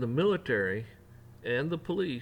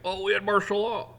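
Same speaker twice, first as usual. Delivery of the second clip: mock excuse; 9 semitones higher, 5 dB louder than usual. Mocking officials' excuse.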